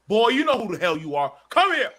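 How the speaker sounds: loud, high parental summons; voiced angry parent. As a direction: imitate a parent yelling for the child. Voices parents calling him sternly.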